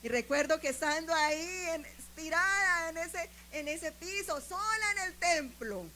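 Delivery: high, loud, emotional recollection; impassioned testimony preaching. Real, not acted. Preaches at full force.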